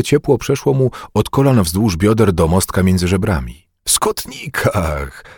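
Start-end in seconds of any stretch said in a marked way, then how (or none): 3.8-5.2 slow, drawn-out exclamation, falling; joyful realization. A narrator's reading. Bugaj suddenly understands and is overjoyed, joyful. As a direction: let sudden joy stretch the word. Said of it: "Niespodziewana radość go poniosła".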